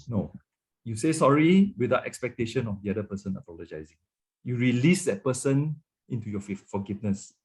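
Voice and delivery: ordinary talk, nothing marked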